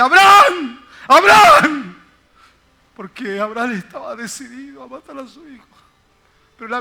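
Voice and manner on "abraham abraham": loud, high, urgent cry of the name; urgent call. Acted. God calling out to Abraham to stop him, loud urgent shout.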